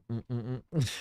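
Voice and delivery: ordinary delivery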